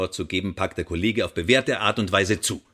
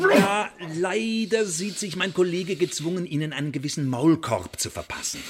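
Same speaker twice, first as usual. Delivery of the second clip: mocking scorn; 8 semitones higher than usual. Interrogator mocks the prisoner.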